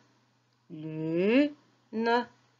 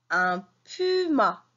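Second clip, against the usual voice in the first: "Un puma" said slowly and clearly; slow modelling; slower than usual. Shown as a demonstration. Teacher modelling "Un puma" slowly for pupils. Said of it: "teacher models word".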